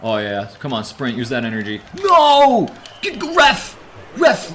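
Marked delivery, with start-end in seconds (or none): 2.0-4.5 high, loud protesting yell; protest at the ref. Real, not acted. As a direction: call out the ref. Protesting a foul.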